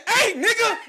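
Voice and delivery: very high, loud laughing mock dad; the dad reacting to his son, high and laughing. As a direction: cackle it as a stunned dad.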